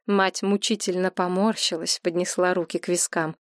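ordinary delivery

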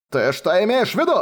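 strained half-shout; almost shouting. A narrator's reading. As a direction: demand it, barely holding back. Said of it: "Он почти кричал".

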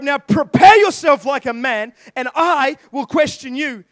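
booming shout of God; God's command. Acted. Preacher voicing God commanding Job, loud booming shout. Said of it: "He says to Job".

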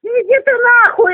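ordinary talk

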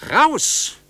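all-out scream to clear the doorway; furious shout. Acted. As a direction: bellow them out of the room. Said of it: "schrie ich".

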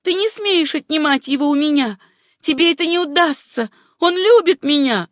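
high, heated possessiveness; defiant protest. A narrator's reading. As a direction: warn off the rival fiercely. Acted quarrel, defiant protest.

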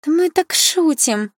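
high, drawn-out whine; pitiful high-voiced backtracking; plaintive. A narrator's reading. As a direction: whine an excuse, slowly. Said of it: "жалобно сказала главная".

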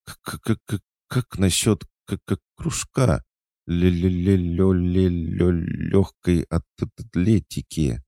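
high, trembling stammer, stuck on the first sounds; frightened stutter. A narrator's reading. Blonde realizes whom she grabbed, frightened high stammer. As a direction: stutter in fear, dragging syllables out high. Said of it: "начала испуганно трястись и заикаться".

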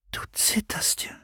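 sehr leise, tonlos gehaucht; Flüstern. Acted. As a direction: sanft ins Ohr flüstern. Madonna hält zitternde Freundin. (Madonna flüstert der zitternden Freundin zu)

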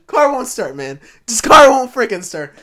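loud, high frustrated outburst; high, loud, worked-up retelling; retold panic. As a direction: shout it in exasperation at the car.

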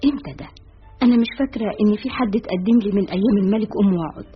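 usual speaking voice

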